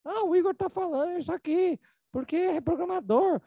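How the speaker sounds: high, dismissive mock voice of a doubter; mocking skeptic. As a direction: sneer like someone who thinks it's nonsense. Mocking skeptics of his work. (imitando um cético debochado)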